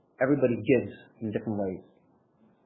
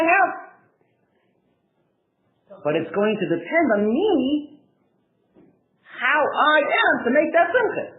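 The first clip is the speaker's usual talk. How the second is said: high, loud impassioned sermon voice, rising to near cries; fervent preaching; 10 semitones higher, 5 dB louder than usual. Acted. Rabbi pleading with listeners, in impassioned high loud preaching. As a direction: preach it with growing fire.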